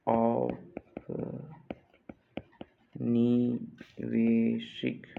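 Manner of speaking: extremely slow औपनिवेशिक with long gaps; syllable by syllable. Shown as a demonstration. Teacher dictating to students, औपनिवेशिक in slow separate syllables. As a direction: spell out औपनिवेशिक sound by sound.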